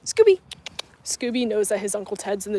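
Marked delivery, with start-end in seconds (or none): 0.1-0.4 high, loud call of the dog's name. Real, not acted. Owner calls out to Scooby, high and loud. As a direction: call out brightly to get the dog's attention. Calling out to her dog.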